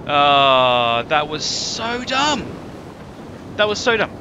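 dismayed lament. Real, not acted. Lamenting again.

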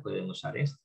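normal talking voice

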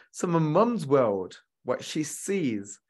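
ordinary talk, nothing marked

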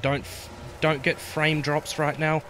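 panicked plea while fleeing; frantic, high and loud; being chased. Real, not acted. Fleeing monster in game.

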